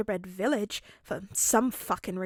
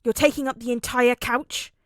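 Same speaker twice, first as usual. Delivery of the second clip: gruff complaint; 7 dB louder than usual. A narrator's reading. Katsuki complains.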